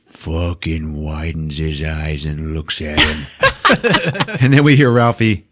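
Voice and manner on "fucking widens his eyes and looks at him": drawn-out low slow-motion narration. Shown as a demonstration. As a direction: stretch each word like slowed-down film. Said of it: "this is all kind of in slow motion".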